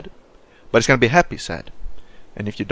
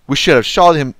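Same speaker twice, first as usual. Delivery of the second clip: loud, frustrated regret; angry regret; 3 semitones higher, 9 dB louder than usual. Real, not acted. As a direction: hammer home the missed chance. Regretting sparing Lily.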